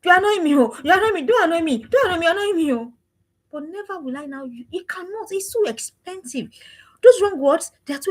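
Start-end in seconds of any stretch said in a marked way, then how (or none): 0.1-2.9 annoyed mother. Retold or imitated. Mother telling her daughter she annoys her, irritated. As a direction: snap at the child in an exasperated mother's voice. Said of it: "you hear me say".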